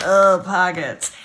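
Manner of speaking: drawn-out, disgusted ugh at pockets; slow, put-upon mock disgust; grossed-out groan. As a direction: say it like pockets are a chore. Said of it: "why I'm like".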